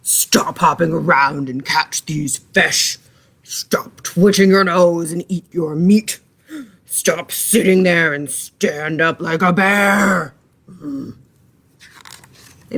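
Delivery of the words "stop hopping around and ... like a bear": lightly voiced gruff bear scolding; mild bear growl. A narrator's reading. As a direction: scold like a grumpy bear, a bit slower. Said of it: "they growled".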